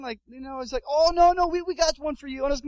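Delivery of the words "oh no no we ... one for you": high, loud, eager reassurance; pushy helper jumping in; imitated insistent guide. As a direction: brush off the refusal eagerly.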